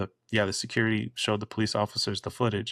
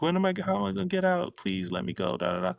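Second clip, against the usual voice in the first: high, fast whiny begging, imitated; squeaky mock sob-plea to be let go; mock crying plea; 8 semitones higher than usual. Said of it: "she was in the car still crying".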